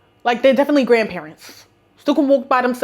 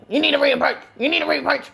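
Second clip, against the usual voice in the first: imitating an annoying manager; mock nagging, a bit higher than usual; 3 semitones higher, faster than usual. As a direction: pester them with the same order. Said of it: "was really annoying and would be like".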